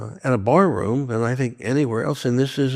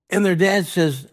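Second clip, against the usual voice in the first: normal talking voice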